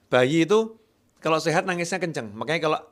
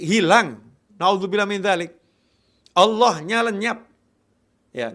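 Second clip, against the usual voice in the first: higher, louder preaching voice; forceful preaching; 6 semitones higher, 5 dB louder than usual. Real, not acted. Preacher warning the congregation about losing faith, loud and forceful. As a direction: drive the warning home from the pulpit.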